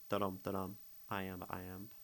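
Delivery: ordinary delivery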